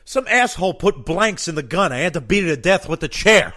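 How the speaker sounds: loud, high, indignant; worked-up rant. Acted. Sweating candidate telling the FBI examiner what happened, loud and high. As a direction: blurt the punchline all fired up.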